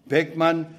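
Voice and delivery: usual speaking voice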